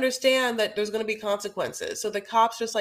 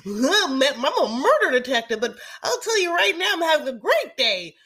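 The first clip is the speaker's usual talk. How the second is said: loud, higher, clueless cheery cop; dopey cop; 6 semitones higher, 7 dB louder than usual. Retold or imitated. Mocking the cops as cheerful and clueless to a friend. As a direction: ham up an oblivious detective. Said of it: "these cops, every turn, act like".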